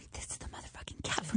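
hushed, awed whisper; breathless secret whisper to yourself. Retold or imitated. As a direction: whisper it like you can't believe your luck. Awed secret aside about cat.

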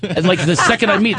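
ordinary talk, nothing marked

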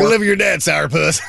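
playful mock yell at a sulky teen; mock shout. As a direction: bark it at the kid like a fed-up parent, half laughing. Mock telling off the daughter.

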